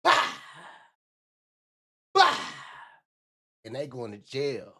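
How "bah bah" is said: high barked gunshot sounds; mock gunshots. Streamer mocking rappers with mimicked gunshot sounds. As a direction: spit out fake gunshot noises. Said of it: "rappers are rapping about".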